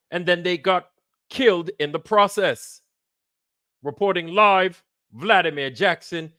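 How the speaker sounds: high, projected fake British reporter; bullshit British accent. British reporter signing off live to the studio, mock British accent. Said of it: "my bullshit British accent trying to act like a British reporter".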